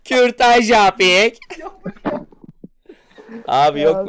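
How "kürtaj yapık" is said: very slow, high, loud call; drawn-out mock slogan. Milking the slogan for laughs, slow mock shout. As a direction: stretch every syllable in a hammed-up cry.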